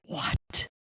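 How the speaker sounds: re-enacted whispered "what"; hushed disbelief. Podcaster retelling own shock at the cashier's revelation, whispered. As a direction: say it on breath alone, as if stunned.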